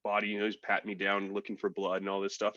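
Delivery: normal talking voice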